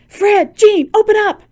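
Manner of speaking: very high, loud, pounding urgency; calling out. Acted. Esther at friends' door, loud excited calling. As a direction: shout through the door.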